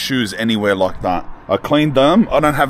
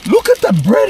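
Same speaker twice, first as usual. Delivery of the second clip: excited; 9 semitones higher, 3 dB louder than usual. Real, not acted. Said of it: "repeated much higher".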